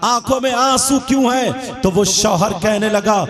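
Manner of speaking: loud sermon with chanted, sung lines; preacher chanting the husband's lament and the wife's comfort to the women; full-force preaching. Acted. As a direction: preach it, half singing the pleas.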